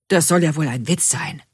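mildly stunned workman; understated incredulity from a gruff workman; stunned disbelief. A narrator's reading. Workman told to undo his work, mildly stunned. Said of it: "sagt der Mann fassungslos".

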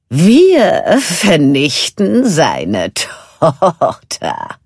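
slow, loud gloating sea witch; kichernde Seehexe. A narrator's reading. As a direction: savour every word like a scheming witch. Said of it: "verkündete Ursula kichernd". (langsam genüsslich kichernde Seehexe)

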